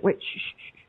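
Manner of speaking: hushed, voiceless shush; shushing whisper. Retold or imitated. Man on the swim trip shushing her, whispered. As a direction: hiss a sharp shush. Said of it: "And he's like".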